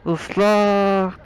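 ordinary talk

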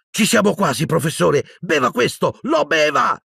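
high, urgent urging, rising to a cry; urgent pleading. Acted. Boy urging his professor to drink the potion, urgent pleading. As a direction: press him desperately to drink.

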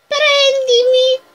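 creepy doll. Acted. Doll voice, drawn out.

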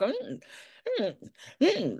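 high mock whimper of a dog; imitating dog whine. Owner mimicking his dog's whine. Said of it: "Then she starts to half whine".

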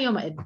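يومئذ closed on a vowelless ð; original sukun form. Shown as a demonstration. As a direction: end يومئذ on ð, without any tanween. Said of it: "هي الأصل".

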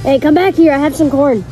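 loud, urgent call; calling him back. Acted. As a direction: shout after someone walking off. Clown calls after departing victim.

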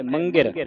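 "ਮੰਗਿਰ" said as one joined word; joined pronunciation. Shown as a demonstration. Teacher shows students how the word is read, "ਮੰਗਿਰ" joined up. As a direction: run "ਮੰਗਿਰ" together without a break. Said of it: "ਪੜ੍ਹਨਾ ਇਕੱਠਾ ਹੈ".

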